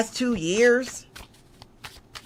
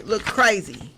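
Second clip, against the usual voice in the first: normal talking voice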